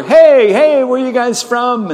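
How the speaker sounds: loud, high-pitched friendly hail; overdone shouted greeting; calling out. Retold or imitated. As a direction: holler over to the newcomers. Calling over to visitors in back row.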